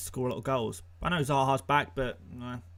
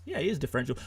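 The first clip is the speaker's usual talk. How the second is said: ordinary delivery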